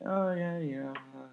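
high, soft, trailing-off 'oh yeah'; noncommittal small talk. Retold or imitated. Runner politely greeting a friend's friend, high, soft and noncommittal. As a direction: imitate polite, half-interested nodding along. Mock polite reply to introductions.

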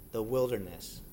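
ordinary delivery

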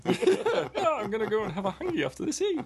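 high, fast, laughing Kiwi puppet voice; squeaky Kiwi Bert, giggling through it; Kiwi accent. Retold or imitated. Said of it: "Bert from Sesame Street like a Kiwi".

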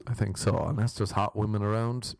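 ordinary delivery

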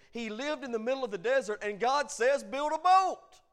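loud, high preaching building to a shout; full-force preaching. Real, not acted. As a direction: build steadily to a thunderous climax.

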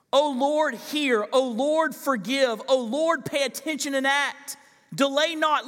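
fervent scripture reading. Acted. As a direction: pray the text aloud with rising urgency. Rereads the pleading verse.

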